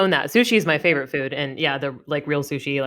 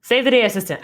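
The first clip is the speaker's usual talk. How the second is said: correcting herself; 6 semitones higher, 4 dB louder, faster than usual. Real, not acted. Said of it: "Oh, so close".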